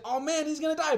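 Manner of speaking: high-pitched onlooker's dismay; mock alarm. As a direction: imitate a crowd fretting, voice way up.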